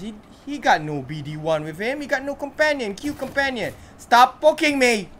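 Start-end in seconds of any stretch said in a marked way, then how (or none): 4.0-5.1 loud, slow, annoyed shout; irritated shout. Real, not acted. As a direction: tell the pest to knock it off. Character keeps poking him.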